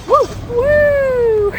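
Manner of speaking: high, loud whoop; excited cheer. Real, not acted. Cheering before the ride.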